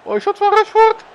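high thrilled cry; excited. Real, not acted. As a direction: burst out as the star appears. Star player gets the ball.

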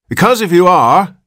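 high, loud cracked complaint; querulous old man. A narrator's reading. Old man in the crib to his father, querulous. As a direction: fuss at him in an aged high voice. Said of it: "went on the old man querulously".

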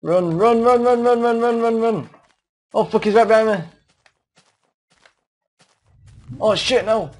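panicked high yelling while fleeing; frantic, shouting run as it chases; being chased. Real, not acted. Fleeing a monster in game.

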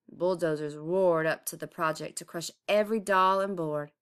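usual speaking voice

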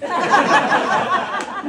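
loud high laugh; high burst of laughter. Real, not acted. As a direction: break into laughter. Laughs again.

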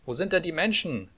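put-on high-pitched little-boy call. A narrator's reading. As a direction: call the question up to a tower. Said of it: "rief der kleine Häwelmann hinauf".

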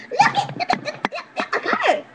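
very high, laughing excitement; giggly squeal. Pretend. Showing viewers the card that came out, giggly squeal.

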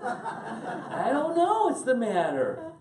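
high, loud, drawn-out comic outburst; playing fed up at full volume; exasperated mock shout. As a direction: blow up in hammed-up exasperation, stretching it slowly. Acting out exasperation at repeated question.

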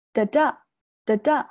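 "哒哒" as a weak beat stepping up to a strong one; light first 哒, heavy second 哒; rising stress pattern. Shown as a demonstration. Teacher sketching a staircase of stress, "哒哒" weak to strong. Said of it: "往上升".

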